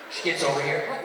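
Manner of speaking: ordinary talk, nothing marked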